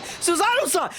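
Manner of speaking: high-pitched panicked call; alarmed cry. Acted. Streamer finding Susato seemingly dead, mock-horrified cry. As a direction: cry out the name in mock horror. Cries out, she seems dead.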